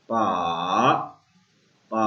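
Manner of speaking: पा dipping low then rising. Shown as a demonstration. Teacher demonstrating third-tone पा. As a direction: dip पा low. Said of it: "third tone".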